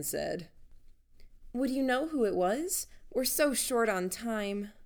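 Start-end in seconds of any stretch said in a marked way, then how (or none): 1.5-4.7 gushing. Acted. Helen keeps gushing at doorman.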